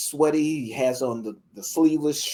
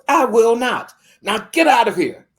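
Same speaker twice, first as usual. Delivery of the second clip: loud high furious dismissal; angry refusal; 8 semitones higher, 10 dB louder than usual. Retold or imitated. Landowner refusing the little girl, loud and furious. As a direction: throw her out with your voice.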